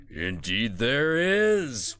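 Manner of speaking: slow, drawn-out oracle voice; stately, stretched-out Buddha pronouncement; golden Buddha. Acted. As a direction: draw out the last word like a deity confirming. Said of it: "very triangular golden Buddha".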